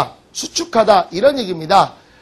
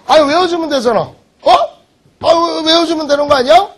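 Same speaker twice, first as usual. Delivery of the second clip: higher-pitched, exasperated nagging; exasperated insistence; 7 semitones higher, 4 dB louder than usual. Real, not acted. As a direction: press the class with raised pitch. Teacher goads students to memorize. (답답한 듯 높은 목소리로 다그치기)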